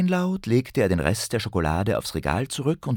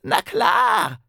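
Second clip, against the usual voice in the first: high, smug goblin voice; cheeky goblin; 10 semitones higher than usual. Acted. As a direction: toss it off like a goblin. Goblin answers on phone.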